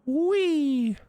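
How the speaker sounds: squeaky high playful "Wee". Retold or imitated. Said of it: "he went".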